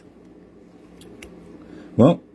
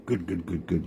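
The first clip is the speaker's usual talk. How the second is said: quiet, lower gloating murmur; softer self-satisfied good good; pleased Coyote; 4 semitones lower, 9 dB quieter than usual. Acted. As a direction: mutter to himself, pleased with the plan. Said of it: "Coyote's there going".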